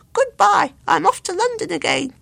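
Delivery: cheeky taunting bird. A narrator's reading. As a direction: tease him with a sing-song.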